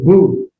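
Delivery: "boo" with a long oo vowel; U sound. Shown as a demonstration. Teacher reading the shurik, long U in "boo". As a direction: round "boo" into a clear U. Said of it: "with a U sound to it".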